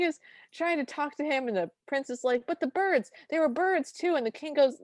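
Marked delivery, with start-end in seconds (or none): 2.5-4.2 high-pitched, earnest mock protest; insistent protest. Prince arguing with the king about the birds, insistent protest. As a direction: plead the case as a retold prince.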